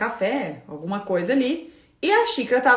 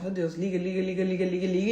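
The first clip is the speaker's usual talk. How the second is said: soft impatient muttering under the breath; muttered impatience; 4 semitones lower, 5 dB quieter than usual. Retold or imitated. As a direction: mutter it to yourself, fed up. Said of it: "impaciência dentro de mim".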